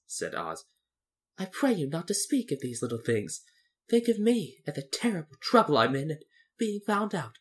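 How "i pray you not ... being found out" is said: lightly voiced, troubled Oz; mildly plaintive little man's voice; pleading little man. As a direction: appeal to them for sympathy.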